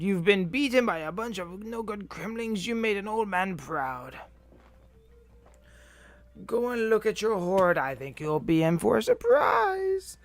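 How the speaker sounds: put-on high, loud old-timer voice; Cranky. Player reading the old man's ending speech, high character voice. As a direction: read it as a crotchety old man.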